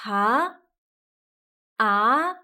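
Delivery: Ha and a lifting like a question; rising tone. Shown as a demonstration. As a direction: let Ha and a rise upward. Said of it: "similar to asking".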